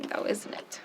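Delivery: ordinary delivery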